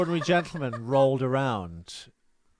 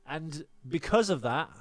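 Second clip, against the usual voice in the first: ordinary talk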